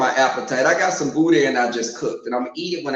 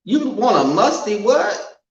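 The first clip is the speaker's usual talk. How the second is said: slow, louder, pitched-up incredulous retort; mock-appalled, raised, slowed reply; indignant disbelief; 8 semitones higher, 3 dB louder, slower than usual. Real, not acted. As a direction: drag out the outrage at the idea. Indignant reaction to the quote.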